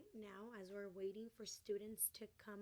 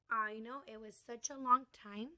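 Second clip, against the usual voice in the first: louder, hammed-up wonder; mock amazement; 11 dB louder than usual. Teacher telling students how long ago it was, hammed-up loud amazement. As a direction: sell it like it's astonishing to little kids.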